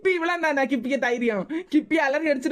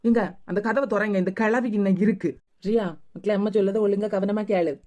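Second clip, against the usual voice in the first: lower, eerie ghost voice; lower, otherworldly ghost calling the child; 5 semitones lower than usual. Acted. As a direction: speak as a haunting spirit from behind the door. Speaking as the ghost.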